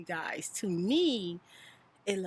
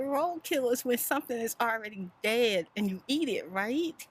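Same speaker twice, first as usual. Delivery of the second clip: puzzled question; 6 semitones higher, 3 dB louder than usual. Retold or imitated. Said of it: "I told my daughter".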